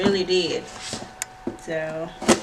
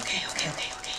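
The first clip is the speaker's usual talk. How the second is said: whisper; 8 dB quieter, much faster than usual. Real, not acted. Whispering to herself.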